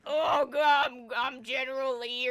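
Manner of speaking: loud hammed-up drunk general; drunk general shout. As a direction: bellow it like a sloppy general. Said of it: "their versions of, like".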